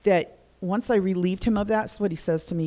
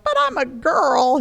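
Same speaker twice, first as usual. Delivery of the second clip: loud, high, drawn-out protest; mock childish wail of objection; emotional young daughter; 7 semitones higher, 7 dB louder than usual. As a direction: whine back at dad as a girl. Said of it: "if I went to him emotional".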